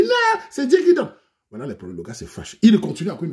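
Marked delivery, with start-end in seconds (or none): 0.0-1.1 very high, louder woman's falsetto; mock girly voice. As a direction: squeak it like a coy young woman. Woman's high piping answer.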